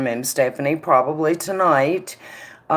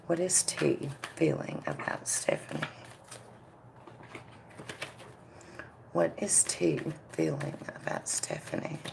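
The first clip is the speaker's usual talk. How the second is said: hushed asking; 15 dB quieter than usual. Real, not acted. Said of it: "question to spirit, fading to hush".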